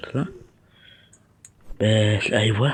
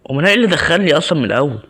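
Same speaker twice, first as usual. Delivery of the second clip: fast, louder frustrated rant; what did I even get into, louder and faster; exasperated outburst; 5 semitones higher, 5 dB louder, faster than usual. Real, not acted. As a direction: vent at the game, quick and loud.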